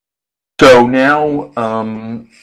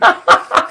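high-pitched giggle. Real, not acted. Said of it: "And I'm laughing".

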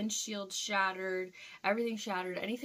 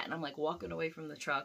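ordinary talk, nothing marked

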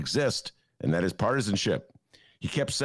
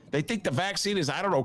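usual speaking voice

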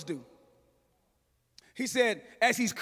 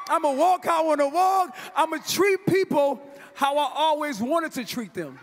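fired-up preaching; 3 semitones higher, 3 dB louder, faster than usual. Real, not acted. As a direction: preach it hard, riffing to the crowd.

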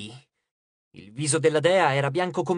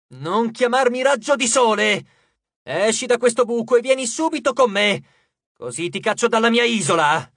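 loud, raised, cornered-animal growl; angry snarl; 5 semitones higher, 6 dB louder than usual. A narrator's reading. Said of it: "ringhiò come un animale messo all'angolo". (ringhio furioso)

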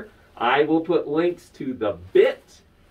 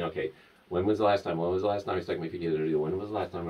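anxious inner thought; 8 semitones lower, 8 dB quieter, much faster than usual. Retold or imitated.

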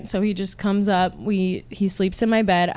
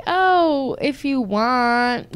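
high oh, then slow, dragged-out consent; reluctant tone; 4 semitones higher, much slower than usual. Retold or imitated. Woman answering a man who wants in, reluctant tone. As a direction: agree slowly while clearly not wanting to. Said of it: "like, in that tone".

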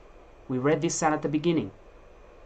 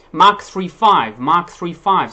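loud announcement; 11 dB louder, slower than usual. Acted. Preacher announcing scripture loudly.

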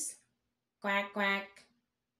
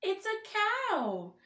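delighted reveal; 7 semitones higher, slower than usual. Acted. Reveal behind the barn door.

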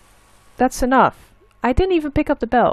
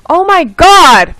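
loud, high furious rant; real furious ranting; angry rant; 6 semitones higher, 8 dB louder than usual. Real, not acted. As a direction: yell at the game makers. Rant at game developers.

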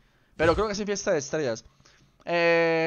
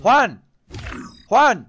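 loud call across the room; calling a friend's name loudly; 5 semitones higher, 9 dB louder than usual. Real, not acted. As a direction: shout his name to get his attention.

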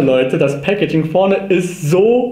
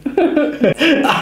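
short high laugh; 12 semitones higher than usual. Real, not acted. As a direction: burst out laughing. Laughs at the buddy paint.

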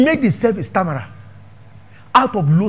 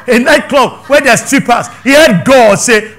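usual speaking voice

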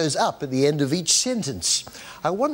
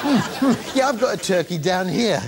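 ordinary talk

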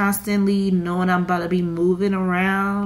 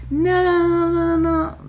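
much higher sing-song no no no; mock security guard; 10 semitones higher, 3 dB louder than usual. She mimics the security man shooing her off, sing-song. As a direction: imitate the guard wagging a finger in song.

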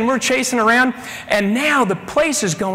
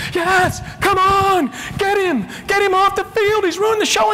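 gleeful shout; 11 semitones higher than usual. Retold or imitated. Band director cheering the police on, high and gleeful. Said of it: "He goes".

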